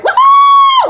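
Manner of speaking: very high, loud "woohoo"; squealed celebratory cheer; joyful whoop. Acted. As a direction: whoop like you're captaining a yacht. Acts herself proudly steering a yacht.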